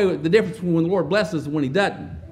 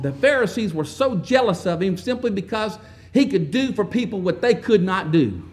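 ordinary talk

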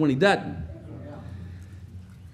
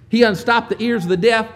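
building preaching; 3 semitones higher, 6 dB louder than usual. Real, not acted. Preacher stirring the congregation about Jesus' healings, rising fervor. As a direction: build the crowd up with each line.

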